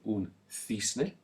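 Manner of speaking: un cisne with the c as th, thisne, said slowly; Spanish th sound. Shown as a demonstration. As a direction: model cisne with a lisped th for the class. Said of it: "We have it again with an E".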